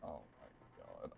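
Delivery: breathless whispered disbelief. Real, not acted. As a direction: barely breathe the words out. Stunned after losing.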